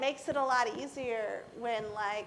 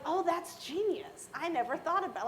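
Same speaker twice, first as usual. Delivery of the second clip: retold wowed reaction, pitched up; amazed realization; 7 semitones higher than usual. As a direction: react as if it's a brilliant revelation. Acted amazed reaction to friend's tip.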